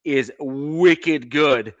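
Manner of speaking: wicked good drawn out very slowly with a Boston lilt; Boston phrase. Shown as a demonstration. Praising McDaniels to the hosts, slow Boston wicked good. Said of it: "That's a Boston phrase".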